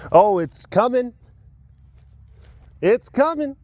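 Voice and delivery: high, building excitement; sing-song hype; hyped-up tease. Acted. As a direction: tease the coming festival. Hyping the festival dramatically.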